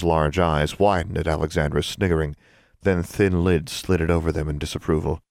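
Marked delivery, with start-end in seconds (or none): none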